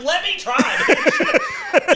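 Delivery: very high, squealed demand; outraged eagerness. Retold or imitated. Man jealous of exhausted friends, eager squeal. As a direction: beg for the chance in a laughing squeal.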